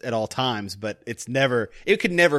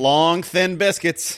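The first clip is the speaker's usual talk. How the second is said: slow announcement; 3 semitones higher, much slower than usual. Pretend. Repeating the phrase as a name.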